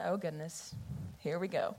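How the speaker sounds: a quiet, weary sigh of oh here we go; resigned mutter. Retold or imitated. Girl dreading worship night, muttering to herself, resigned. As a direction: mumble it under your breath, bracing for it. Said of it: "and I thought".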